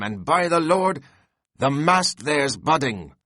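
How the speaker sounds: Stubb rallying the crew. A narrator's reading. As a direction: whip the crew on with hearty shouts. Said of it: "cried Stubb to his crew".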